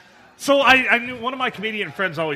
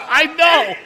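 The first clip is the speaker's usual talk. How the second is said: much higher and louder, laughing; exasperated laughing shout; 9 semitones higher, 9 dB louder than usual. Real, not acted. Comedian corrected on Lincoln mix-up, loud laughing exasperation. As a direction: belt out the embarrassed admission. Repeats it after being corrected.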